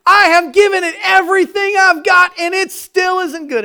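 loud, high cry of giving up; exasperated shout. Retold or imitated. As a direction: yell it like you're at your limit. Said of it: "When was the last time you said".

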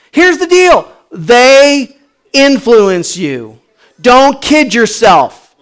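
high, loud, challenging questions with pauses; full-force preaching. Real, not acted. Preacher challenging congregation about their minds, forceful and loud. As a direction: press each question on the crowd.

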